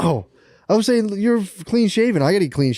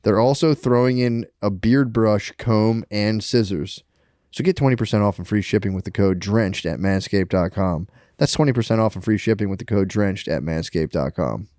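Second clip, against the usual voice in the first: ad read; 4 semitones lower than usual. Acted. Reads a sponsor ad.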